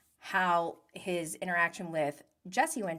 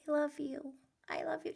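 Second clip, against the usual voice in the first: put-on lightly high, soft cooing to a toddler; baby talk; 8 semitones higher, 7 dB quieter, faster than usual. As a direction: coo it like a parent on FaceTime. Parents FaceTiming their baby.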